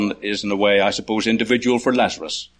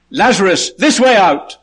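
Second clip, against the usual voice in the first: high, raised summons; calling out; 8 semitones higher, 5 dB louder than usual. Acted. The Lord calling believers at his return, high loud summons. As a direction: call it out brisker, pitched high and strong. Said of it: "the word that we'll hear".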